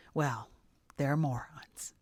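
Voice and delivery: ordinary talk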